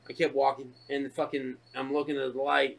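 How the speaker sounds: usual speaking voice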